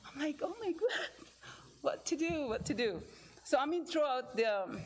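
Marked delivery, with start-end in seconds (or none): 0.4-3.0 high, flustered oh my God; mock panic. Telling how she reacted to the boy's sadness, in high mock panic. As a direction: fret in mock alarm over leaving. Boy sad she is leaving.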